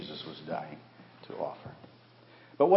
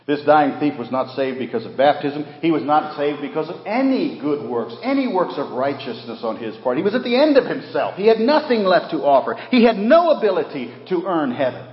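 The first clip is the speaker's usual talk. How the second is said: high, loud, ringing preacher climax; preaching at full force; 7 semitones higher, 11 dB louder than usual. Acted. Preacher to his congregation, rising high and loud. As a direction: lift it and drive it home to the church.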